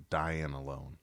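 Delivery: "dying alone" in a light Canadian accent, low. Shown as a demonstration. Explaining the label's pun, light Canadian accent. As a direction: stretch "dine" into "dying", Canadian-style. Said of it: "if you say it the right way in a Canadian accent".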